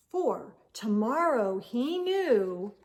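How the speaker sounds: ordinary delivery, nothing marked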